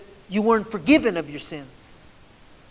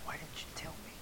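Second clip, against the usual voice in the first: hushed, breathy plea; soft whispered why; 20 dB quieter, faster than usual. Acted. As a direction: whisper the question like a wounded secret. Quoting a grieving plea.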